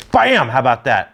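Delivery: high, loud exclamation that drops after the first word; punchy showman's bam; excited reveal. Real, not acted. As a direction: shout it to unveil a prize. Unveiling the next lure excitedly.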